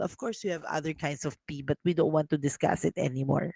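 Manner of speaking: ordinary delivery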